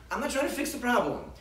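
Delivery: ordinary talk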